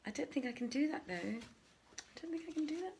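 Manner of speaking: ordinary delivery, nothing marked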